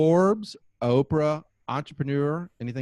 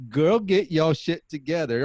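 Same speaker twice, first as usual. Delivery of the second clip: slow, higher-pitched Texas drawl; playful Texas accent; 4 semitones higher, 4 dB louder, slower than usual. Acted. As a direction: draw it out with a Texas twang. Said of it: "kind of a Texas accent".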